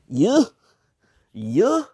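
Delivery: "You?" said high and loud, as a puzzled question; sounding out the brand. Shown as a demonstration. Asking viewers how to say the brand, "You?" high and puzzled. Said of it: "pronouncing it how my brain sees it".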